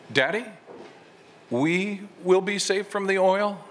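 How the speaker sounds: a lightly voiced small child, pitch rising high; child's question. Young son asks his father about the oil, lightly childlike. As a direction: ask it up high like a worried little boy.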